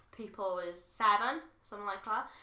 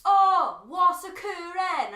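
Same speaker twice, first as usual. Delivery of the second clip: loud, drawn-out, slow bad Welsh accent; Irish tourists mocking a Welsh accent, loud and slow; 9 semitones higher, 11 dB louder, much slower than usual. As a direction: ham up an Irish attempt at Welsh, slowly. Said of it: "Irish people can't do Welsh accent very well".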